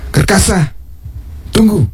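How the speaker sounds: high call after someone leaving. Acted. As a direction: call him back before he goes. King calls departing man back.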